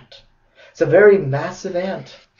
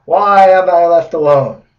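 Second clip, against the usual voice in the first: louder, slow mock wail; lonely pineapple pleading through tears, slow and loud; crying pineapple; 4 semitones higher, 11 dB louder, slower than usual. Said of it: "These big fat pineapple tears saying".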